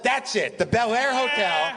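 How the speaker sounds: high, triumphant mock exclamation; Mel Brooks. Mel finally hearing the hotel's name, hammed-up triumph. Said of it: "he goes".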